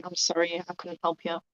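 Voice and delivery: soft, hushed apology; whisper. Acted. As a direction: barely breathe the words. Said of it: "just gonna whisper".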